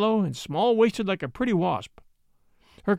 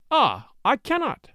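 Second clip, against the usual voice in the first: put-on slow, high childish pout; drawn-out pouting complaint; 6 semitones higher, slower than usual. A narrator's reading. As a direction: give up with a pout.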